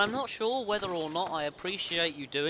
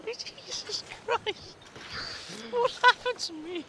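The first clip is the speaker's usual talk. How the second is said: squeaky shocked cry, much higher; high-pitched dismay; slower than usual. Acted. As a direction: squeal it out in mock despair.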